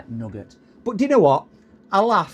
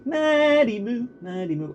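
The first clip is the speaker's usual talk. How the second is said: slow, high singsong call; singsong pet name; 10 semitones higher, slower than usual. Real, not acted. Owner crooning his dog's pet name.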